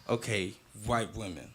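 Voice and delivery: low, quiet voicing of a thought; men's inner thought. Voicing men headed to London, quiet musing. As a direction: drop low and soft, as if thinking to yourself. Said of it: "men go into London thinking".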